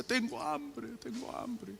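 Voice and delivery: put-on high, fading whimper of a hungry child; tearful small boy pleading; child's crying plea. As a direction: let it break into a soft sob, voice dying away. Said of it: "Y lloraba ahí parado".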